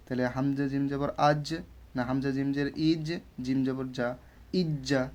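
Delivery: ordinary talk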